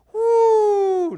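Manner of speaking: a high, loud whoop on the drop; high whoop of a coaster ride; roller coaster whoop. Acted. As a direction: whoop as if plunging down.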